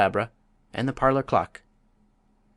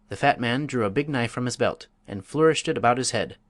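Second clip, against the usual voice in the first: plain reading voice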